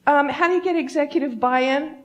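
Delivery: high-pitched mock asker; mock conference questioner. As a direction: parody an earnest questioner from the audience.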